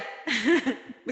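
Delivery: a short amused chuckle; quick warm giggle; soft laugh. Real, not acted. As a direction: laugh lightly at your own joke. Joke about Javanese questioner.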